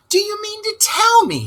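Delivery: Rat shouting. Acted. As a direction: burst out at Toad in alarm. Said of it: "shouted the rat".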